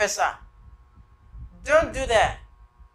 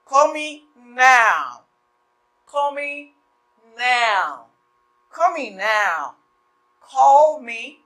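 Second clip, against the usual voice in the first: teacher's slow model; slow drill; slower than usual. Shown as a demonstration. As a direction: spell out "Call me now" slowly. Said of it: "M pa di call, m di call me".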